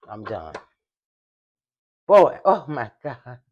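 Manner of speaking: ordinary talk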